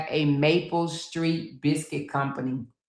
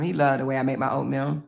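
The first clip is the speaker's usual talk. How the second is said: ordinary talk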